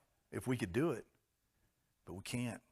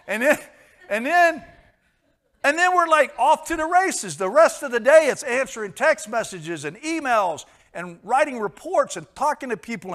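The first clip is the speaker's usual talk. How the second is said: higher and louder with each and then; building excited rush; 8 semitones higher, 11 dB louder than usual. Real, not acted. Preacher describing the day's rush, rising excited delivery. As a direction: pile it on, rising to a frantic pitch. Racing through the busy day.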